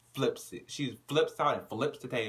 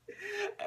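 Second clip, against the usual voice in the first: high burst of laughter; 6 dB quieter than usual. Real, not acted. Speaker laughing.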